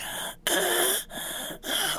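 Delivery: breathy high gasping for air; imitated airless gasp. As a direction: gasp like she can't get air. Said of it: "she, like, starts".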